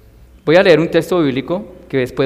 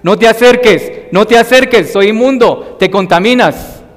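higher, louder urgent call to keep away; leper shouting warning; 6 semitones higher, 5 dB louder than usual. Retold or imitated. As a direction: warn strangers off at the top of your voice. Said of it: "Tenían que gritar como una sirena".

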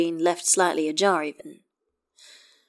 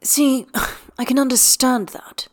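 sighing; 7 semitones higher, 4 dB louder than usual. A narrator's reading. Astarion concedes to Gale with a sigh, sighing. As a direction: sigh then a higher, louder line. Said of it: "Astarion sighs".

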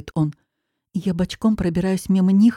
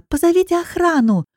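scream for help; 11 semitones higher, 4 dB louder than usual. Acted. Girl dragged to a car, screaming for help. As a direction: shout for anyone to hear. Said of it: "кричу я".

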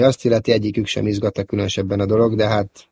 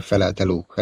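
ordinary delivery, nothing marked

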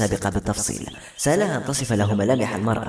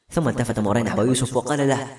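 plain reading voice

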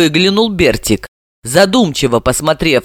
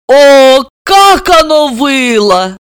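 high, slow anguished moan; wailing lament; 9 semitones higher, 5 dB louder, much slower than usual. A narrator's reading. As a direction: groan it out loudly, drawn out. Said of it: "простонала".

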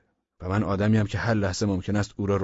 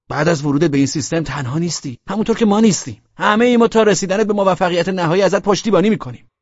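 high, loud pep talk; rally the newcomer with mounting fervour; fired up; 8 semitones higher, 7 dB louder than usual. A narrator's reading. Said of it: "کلهٔ حسن هم داغ شد". (پرشور، بلند و با هیجان)

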